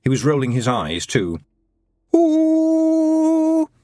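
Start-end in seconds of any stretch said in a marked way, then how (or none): 2.1-3.7 high, loud drawn-out ooh; theatrical moan. A narrator's reading. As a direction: wail it like a tortured poet. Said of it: "very theatrically".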